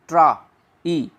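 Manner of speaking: ट्रा, ई said slowly as two separate sounds; split into parts. Shown as a demonstration. Teacher breaking the diphthong for students, slow ट्रा then ई. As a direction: pull ट्रा and ई apart, slowly. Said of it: "आ भी बोला जाएगा और ई भी बोला जाएगा".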